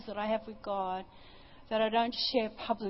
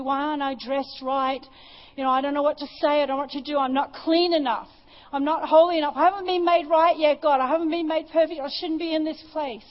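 retold frantic panic; 4 semitones higher, 8 dB louder than usual. Retold panic, not worthy.

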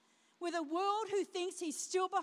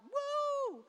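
excited whoop; 11 semitones higher, 3 dB louder than usual. Real, not acted. Repeats excited cheer.

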